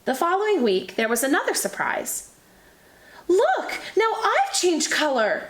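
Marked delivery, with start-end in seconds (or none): 3.3-5.5 cried out. Acted. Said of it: "Paige cried out".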